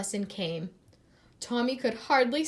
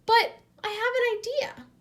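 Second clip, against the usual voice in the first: much higher, louder cheerful offer; bright idea; 13 semitones higher, 6 dB louder than usual. A narrator's reading. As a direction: announce it brightly. Art teacher brightens.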